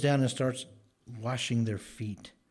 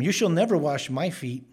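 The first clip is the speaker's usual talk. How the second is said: loud, higher-pitched emphatic protest; insistent, raised-voice objection; emphatic refusal; 5 semitones higher, 9 dB louder than usual. Retold or imitated. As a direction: refuse outright, voice lifted and forceful. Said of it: "It's emphatic".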